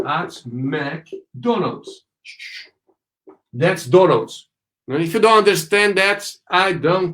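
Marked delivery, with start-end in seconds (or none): 0.1-2.1 softer, very slow, "McDonald's" split in pieces; broken-up model phrase. Shown as a demonstration. Teacher breaking the phrase down for students, very slow. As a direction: pause between "at", "Mc" and "Donald's".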